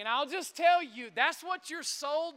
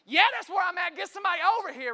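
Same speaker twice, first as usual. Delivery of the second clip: higher, louder frantic shout; freaking out; 5 semitones higher, 5 dB louder, much faster than usual. Retold or imitated. Father demanding help from the dispatcher, acted frantic shout. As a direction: shout for help, acting out the desperation. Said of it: "I'm freaking out".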